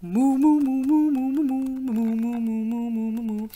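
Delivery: sung cow mooing; singing moo. Acted. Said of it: "they started singing".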